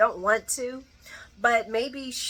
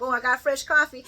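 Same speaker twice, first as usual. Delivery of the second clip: imitating Brooklyn; 5 semitones higher, 5 dB louder than usual. Acts out Brooklyn entering for coffee.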